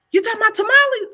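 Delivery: high, loud astonished disbelief; amazed question. Retold or imitated. Chef imitating amazed customers hearing of tamales, high and loud. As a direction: act out the crowd's shock. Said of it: "they mouth".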